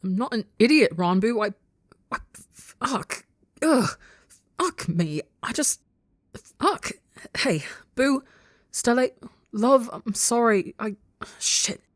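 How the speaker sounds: high, choked, broken bursts; upset, near tears. A narrator's reading. Outburst breaking into tearful swearing.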